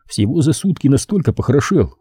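ordinary delivery

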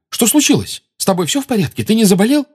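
worried to the bone; frightened worry. A narrator's reading. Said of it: "вне себя от испуга".

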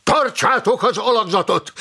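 high, forceful battlefield bellow; bellowed command. A narrator's reading. Commander to his men as the dead close in, bellowed command. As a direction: bellow it across the ranks. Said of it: "bömbölte".

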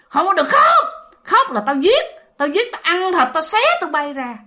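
high, forceful scolding; retold angry threat. Woman forbidding children to cry, threatening and high. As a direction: act out a menacing woman silencing children. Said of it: "Bà cứ nói rằng".

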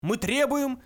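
high, loud outburst; roaring demand. A narrator's reading. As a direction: roar it out as a protest. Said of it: "взревел Врумфондель".

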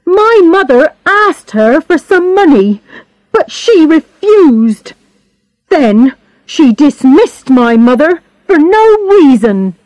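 high-pitched, aggrieved outburst; angry. A narrator's reading. Bernard tells how his mother was dismissed, angry complaint. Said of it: "he cried angrily".

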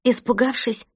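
ordinary delivery

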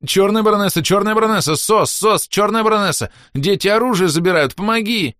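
high loud frantic cry for help; panicked SOS. A narrator's reading. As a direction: call for rescue in mock panic. Whisper calls SOS.

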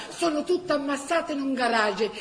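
ordinary delivery

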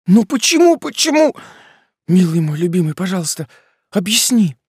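high, loud desperate begging; begging through tears. Acted. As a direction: beg him to explain, near sobbing. Girlfriend begs for reasons.

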